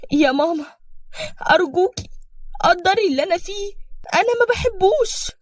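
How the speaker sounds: high, rising desperate plea; pleading daughter. A narrator's reading. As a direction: beg your mother not to force it. Daughter begs her mother. (توسل بصوت عالٍ يائس)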